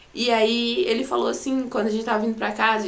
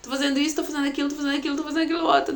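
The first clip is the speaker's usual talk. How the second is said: higher-pitched, speeding up through the list; rattled-off protest; 5 semitones higher, much faster than usual. Retold or imitated. Patient insisting to her doctor she's already doing everything, rattled off higher. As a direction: reel off a retold, insistent protest.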